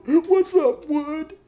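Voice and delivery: hammed-up crying in a very high squeak; Josh. Crying inmate greeting his buddy back, hammed-up weepy falsetto. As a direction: answer through exaggerated weepy falsetto. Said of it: "Josh is crying and stuff".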